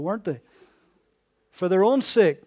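ordinary talk, nothing marked

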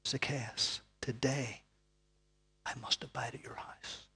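soft, low whisper; gentle whisper. Acted. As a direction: invite him gently, almost breathed. Jesus gently inviting Zacchaeus.

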